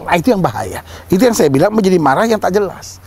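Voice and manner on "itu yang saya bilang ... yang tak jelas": ordinary delivery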